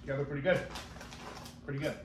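eating snack while speaking; talking while chewing chips. Real, not acted. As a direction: mouth partly full. Seen 1: hand to mouth repeatedly, eating chips from bag while speaking.

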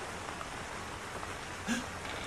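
sharp breathless gasp of fright; startled gasp at a scare. Real, not acted. Gasping as the game jumps him.

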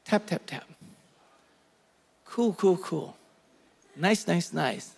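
soft, limp, bored taps; mock him doing the bare minimum; lazy, uninterested mumble; half-hearted king. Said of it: "King Joash goes".

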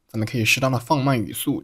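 normal talking voice

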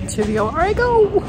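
high, theatrical sing-song. Real, not acted. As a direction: announce it like a hero. Heading off to surgery dramatically.

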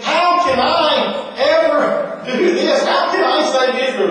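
high, pleading with a laugh in it; anxious Gideon. Acted. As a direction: plead like a frightened man out of his depth. Gideon voiced doubting.

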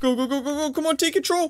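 high-pitched, hurried go-go-go; frantic urging. Real, not acted. Player to friend at the controls, frantic urging. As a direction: push your friend to act now.

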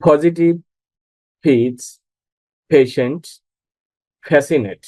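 পজিটিভ, ফিটস, পেশেন্ট, ফ্যাসিনেট opening on aspirated "ph". Shown as a demonstration. Teacher models P words for learners, P as ফ. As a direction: puff air on the first sound of পজিটিভ, ফিটস, পেশেন্ট, ফ্যাসিনেট. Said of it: "ফ এর মতো".

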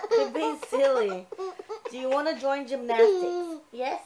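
talking through laughter; laughing. Real, not acted. Laughing with toddler.

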